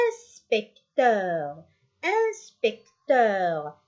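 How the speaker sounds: "Inspecteur" slowly as ins, pec, teur; slow teacher's dictation of "Inspecteur"; syllable by syllable. Shown as a demonstration. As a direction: sound out each syllable of "Inspecteur". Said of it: "إنس نسمعه الأول".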